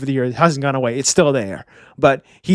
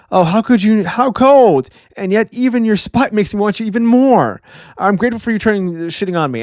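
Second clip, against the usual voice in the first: higher, hammed-up lovesick whine; creepy swooning fan; 5 semitones higher than usual. Road swooning higher over Gerd's cold rejection. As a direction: gush over her insults like a besotted creep.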